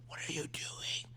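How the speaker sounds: hushed whispered yell. Shown as a demonstration. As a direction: yell it under your breath. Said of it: "That's the type of yell".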